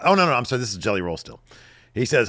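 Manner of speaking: usual speaking voice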